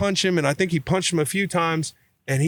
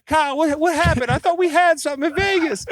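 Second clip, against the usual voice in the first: high-pitched mock North Carolina accent; Will's North Carolina accent. Teammate appealing to Kyle on the next play, high North Carolina accent. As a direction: plead in a squeaky, high Carolina twang. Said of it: "He's got this North Carolina accent".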